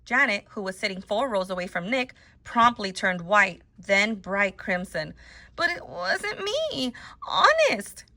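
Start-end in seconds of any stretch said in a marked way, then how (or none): 5.6-8.0 high, slow, pleading whine; near tears. A narrator's reading. Wrongly accused girl to her teacher, high tearful protest. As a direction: plead innocence with a catch in the voice. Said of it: "There was a catch in Janet's voice, as if she might cry".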